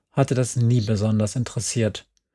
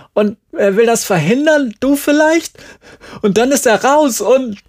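higher and louder, on the edge of tears; crying it out as it overflows; tearful outburst; 11 semitones higher, 5 dB louder than usual. Acted. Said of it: "Jerry liefen jetzt Tränen über ihr Gesicht".

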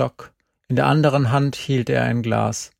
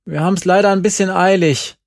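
loud, higher, fast apology; calling back in a hurry; 6 semitones higher, 6 dB louder, faster than usual. A narrator's reading. Girl calls back loudly and quickly, excusing herself. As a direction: rush it out while backing away. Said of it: "rief ich zurück".

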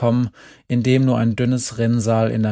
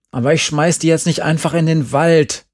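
loud, higher defiant cry; called out; 5 semitones higher, 6 dB louder than usual. A narrator's reading. Andi yelling back at Glenn, refusing to dump the cat. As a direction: shout back, stubborn. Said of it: "rief ich".

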